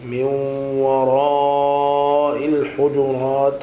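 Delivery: slow chant holding the aa of وراء; connected madd. Shown as a demonstration. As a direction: stretch وراء on one sustained note. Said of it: "هكذا المد المتصل".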